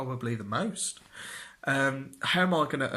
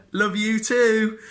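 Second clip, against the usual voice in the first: sarcastic love you too; 9 semitones higher, 8 dB louder, slower than usual. Retold or imitated. Sarcastic reply to mum.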